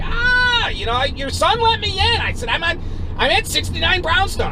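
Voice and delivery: high, frantic protest while being shoved; yelling back. Retold or imitated. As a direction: plead your case at a yell. Retold shouting match at the door.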